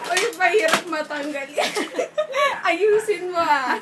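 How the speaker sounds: loud, laughing complaint; laughing protest. Real, not acted. Mascara mishap, laughing protest.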